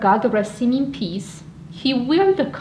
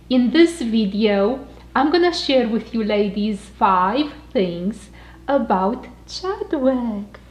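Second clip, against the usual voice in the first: usual speaking voice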